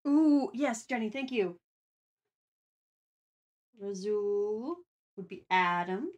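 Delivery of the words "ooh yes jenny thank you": ordinary delivery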